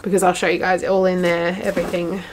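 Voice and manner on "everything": normal talking voice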